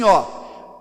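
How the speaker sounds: ordinary talk, nothing marked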